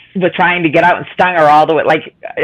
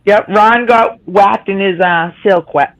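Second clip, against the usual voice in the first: slow stumbling tongue twister; slower than usual. Real, not acted. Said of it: "I can't even say it".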